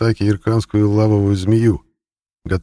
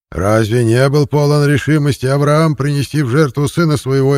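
higher, louder sermon voice; fiery preaching; 7 semitones higher, 4 dB louder than usual. Acted. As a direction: preach it to the flock.

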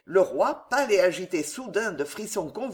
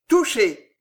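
loud, higher-pitched exclamation; narrated cry, raised and louder; cried out; 4 semitones higher, 7 dB louder than usual. A narrator's reading. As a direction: call out the hit. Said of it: "cria Crucé".

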